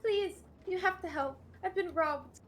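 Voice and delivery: high-pitched distressed victim voice; acted plea, pitched well up; pleading. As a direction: beg urgently for help. Robbed woman begging for help.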